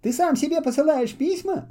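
mild storybook disbelief; Half-Boot amazed that Muffle writes to himself; surprised. A narrator's reading. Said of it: "удивился в свою очередь Полботинка".

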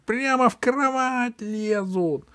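high, slow whiny princess; capricious voice. Acted. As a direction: whine like a spoiled princess. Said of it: "сказала принцесса капризным голосом".

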